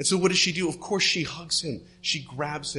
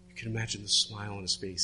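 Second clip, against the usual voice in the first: ordinary delivery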